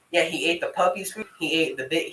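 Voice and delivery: normal talking voice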